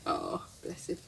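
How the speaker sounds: fond whisper, bless him; soft whispered affection; whispered aside. Real, not acted. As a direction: breathe it out tenderly. Fond aside after laughing.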